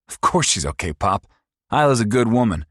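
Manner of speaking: high, loud, fast mocking sneer; sneering abusive son. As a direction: taunt her like a cruel bully. Paul voiced again.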